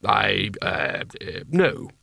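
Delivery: unsure, stammering reply; awkward hesitation. A narrator's reading. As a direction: hedge and stumble before answering. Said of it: "Carding coughed".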